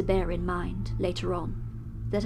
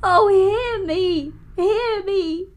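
much higher and louder, begging with tears, slowing to a sob at the end; tearful desperate pleading; 11 semitones higher, 10 dB louder than usual. Acted. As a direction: beg for your soul in a torrent of tears. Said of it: "with the tears rolling down his cheeks".

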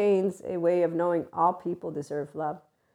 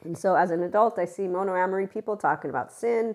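ordinary talk, nothing marked

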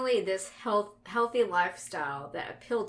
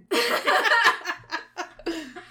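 big burst of laughter; loud laugh; 10 semitones higher, 7 dB louder than usual. Real, not acted. Laughing at cult talk.

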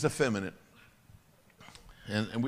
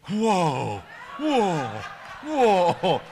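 loud stretched whoa, rising louder; amazed whoa; 3 semitones higher, 7 dB louder, much slower than usual. Acted. Pastor acts Derek blown away, loud whoa. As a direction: exclaim in amazement. Crowd-style amazed exclamation.

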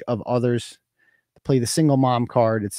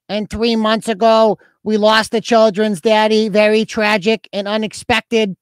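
put-on Newport Lights accent; 8 semitones higher, 4 dB louder than usual. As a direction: read her post in a high, monotone put-on voice. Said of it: "I already know what she sounds like".

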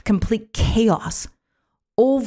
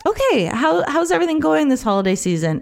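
mock interviewer; 7 semitones higher, faster than usual. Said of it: "I might go out and do live interviews".

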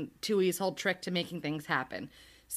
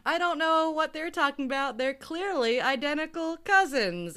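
mock innocent bluff; 7 semitones higher, 4 dB louder than usual. Parents bluffing the police, acted.

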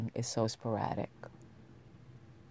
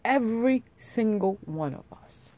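impassioned; 8 semitones higher, 5 dB louder than usual. Real, not acted. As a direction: proclaim it with rising fervor. Impassioned speech on equality.

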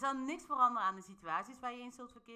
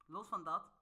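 usual speaking voice

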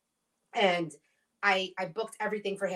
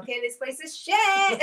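louder, higher, slower mock declaration; mock blunt verdict; 9 semitones higher, 6 dB louder than usual. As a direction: spell it out like the place finally snapped. Imagined workplace turning on her.

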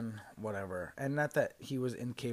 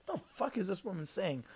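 baffled; 6 semitones higher, 4 dB louder than usual. Retold or imitated. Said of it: "looked at each other like".

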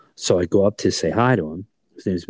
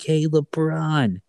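cheeky cartoon rabbit voice, much higher; Bugs Bunny voice; 8 semitones higher than usual. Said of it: "in Bugs Bunny's voice".

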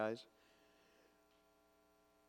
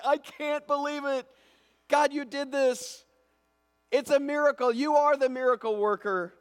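high, loud, joyful cry of thanks; joyful disbelief; 12 dB louder than usual. Acted. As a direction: exclaim it like you can't believe the miracle. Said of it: "laughing the whole time".

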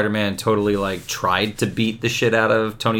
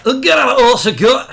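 higher, louder hammed-up starship officer voice; mock Star Trek crewman; 11 semitones higher, 6 dB louder than usual. Mocking the writer's dialogue, in a louder hammed-up crewman voice. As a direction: play the crewman again, bigger. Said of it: "Grant Morrison now".

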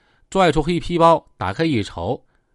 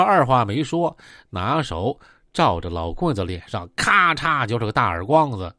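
usual speaking voice

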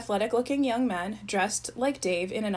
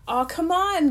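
higher, louder teasing coax; kidding; 8 semitones higher, 5 dB louder, slower than usual. Acted. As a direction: tease him out of the boat. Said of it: "kidded the driver".

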